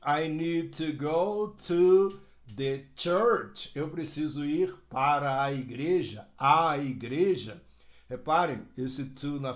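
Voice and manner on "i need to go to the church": I need to go to the church said slowly and clearly; teacher demo of the sentence; slow model sentence. Shown as a demonstration. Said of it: "E eu preciso ir para onde?".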